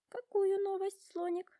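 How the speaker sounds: eager high questioning voice; curious giraffe. A narrator's reading. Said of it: "любопытствует Жирафа".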